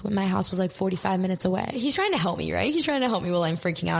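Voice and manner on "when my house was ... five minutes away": normal talking voice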